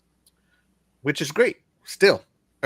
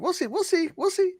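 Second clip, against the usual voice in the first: very high-pitched repeat; teasing squeak. Pretend. Host reacts to bingo card comment, teasing squeak. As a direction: squeak it out, far above your voice.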